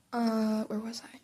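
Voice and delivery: breathy whisper to herself, losing her place; whispered aside. Real, not acted. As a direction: murmur it under your breath, lost in the text. Reader loses place, aside whisper.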